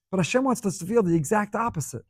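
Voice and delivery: normal talking voice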